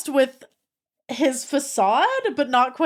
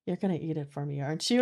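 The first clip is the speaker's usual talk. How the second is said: lower, much softer teasing flirt; seductive idol mode; 7 semitones lower, 8 dB quieter, much faster than usual. Retold or imitated. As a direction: purr it to make her melt. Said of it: "turns on, like, a [name] mode".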